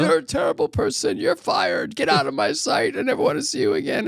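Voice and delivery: high indignant boss yelling him out; hammed-up furious pizza boss; boss firing him. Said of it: "He's like".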